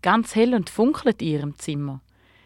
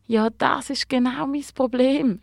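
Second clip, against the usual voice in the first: mildly acted sobbing, pitched high; a touch of tearful complaint; 6 semitones higher than usual. A narrator's reading. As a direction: wail it a little, as a storybook star in trouble. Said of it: "schluchzt der Gabriel".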